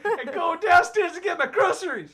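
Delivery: high, loud mock-country voice with laughter; hipster country guy. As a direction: proclaim the errand like a country boast. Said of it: "He's a hipster country guy".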